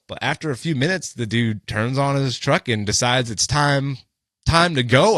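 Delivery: usual speaking voice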